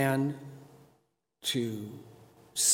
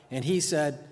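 ordinary talk, nothing marked

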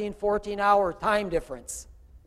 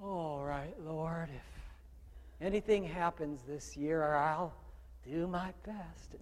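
half-hearted; 4 semitones lower, 13 dB quieter, slower than usual. Retold or imitated. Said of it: "you can't be passive".

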